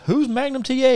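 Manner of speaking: high-pitched mock bewilderment; retold clueless question; imitating puzzled star. Retold Jake asking, clueless.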